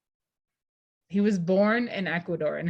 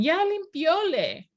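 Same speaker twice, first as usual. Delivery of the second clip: put-on higher, slow childish voice; playful child imitation, higher and slow; imitating son's mistake; 10 semitones higher, 3 dB louder, slower than usual. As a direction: mimic a small boy's wrong Spanish. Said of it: "And he'll say, he'll, he'll respond".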